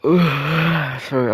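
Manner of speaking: talking through a yawn; words stretched out by a yawn. Real, not acted. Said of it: "I was yawning".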